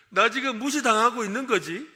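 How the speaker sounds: hammed-up wounded pride; playful hurt, loud; mock offended question. Shown as a demonstration. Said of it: "라고 해주라는 거예요".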